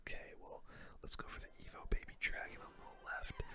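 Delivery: ordinary delivery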